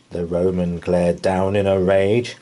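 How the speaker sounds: usual speaking voice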